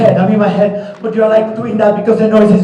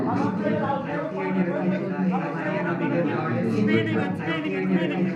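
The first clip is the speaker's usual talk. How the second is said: much softer, almost to herself, over music; hushed confession; 2 semitones higher, 13 dB quieter than usual. Acted. Performer confessing her fears to the audience, much softer. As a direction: confess your fears under your breath.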